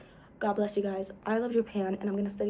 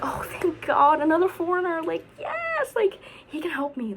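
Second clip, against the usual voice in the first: relieved excitement; 7 semitones higher than usual. Retold or imitated. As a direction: squeal with relief at finding help. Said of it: "and I'm like".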